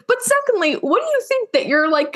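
usual speaking voice

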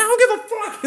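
very high, loud mock outburst; imitated enraged dad. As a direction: cut him off as the furious father.

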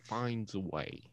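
halting film-star impression; hesitate, then deliver the famous line; Jeff Goldblum. Having another go at the impression for friends, Jeff Goldblum. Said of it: "Jeff Goldblum impression".

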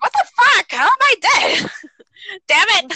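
high, loud outraged yell; high, loud, laughing mock rage; mock outraged ghost. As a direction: shout it like a furious new ghost. Imitating self as angry ghost.

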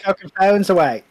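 admittedly bad Aussie accent, fast; quick, hammy Australian shout. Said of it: "I can't do the Australian accent".

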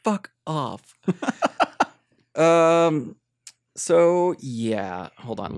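ordinary delivery

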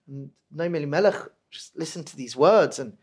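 normal talking voice

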